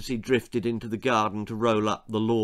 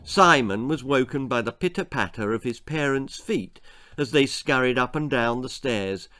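ordinary delivery, nothing marked

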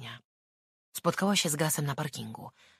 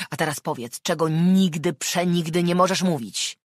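menacing demand; 4 semitones higher, 9 dB louder than usual. Acted. Man threatening shaken girl.

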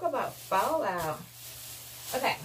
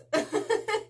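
high laugh; 12 semitones higher than usual. Real, not acted. Laughs after punchline.

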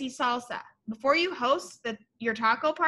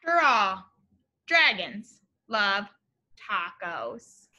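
slow, stretched dragons love tacos; drawn-out refrain; 5 semitones lower, slower than usual. A narrator's reading. Teacher reading the title refrain slowly. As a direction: savor each word of the title line. Signature refrain read aloud.

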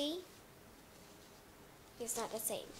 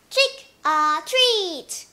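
loud trick or treat; 6 semitones higher, 14 dB louder, much slower than usual. Acted. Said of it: "And the children will say".

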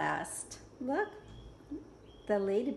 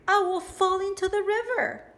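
higher, louder little bug voice; high ladybug voice; 8 semitones higher, 5 dB louder than usual. Acted. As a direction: fret in a tiny high voice. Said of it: "said the lazy ladybug".

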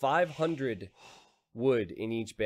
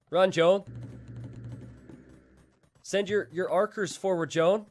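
mock battle cry; 8 semitones higher, 6 dB louder, slower than usual. Punning battle cry at unit.

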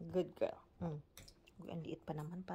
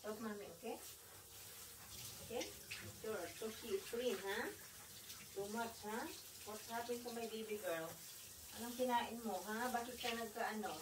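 much softer, tender coaxing; 12 dB quieter than usual. Real, not acted. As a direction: murmur gently to the wet dog. Soft talk to dog in bath.

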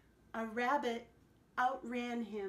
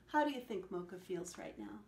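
ordinary delivery

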